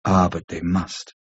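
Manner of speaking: low, slow and grave. A narrator's reading. Mina gravely tells Seward the records must be heard, slow and low. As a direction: weigh each word gravely, slow and low. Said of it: "said very gravely".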